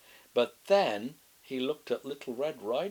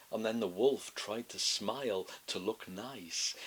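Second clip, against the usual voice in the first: plain reading voice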